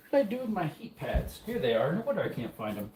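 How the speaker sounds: normal talking voice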